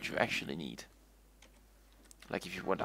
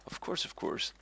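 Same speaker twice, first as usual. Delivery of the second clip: breathy whispered repeat; whispering. Shown as a demonstration. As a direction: whisper it to yourself. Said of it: "I'm repeating myself".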